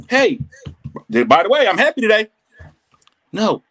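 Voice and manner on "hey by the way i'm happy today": loud, brisk call to passers-by; hammed-up calling out on the street; mock happy announcing. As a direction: announce it loudly, mocking show-offs. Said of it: "always gotta scream, they happy".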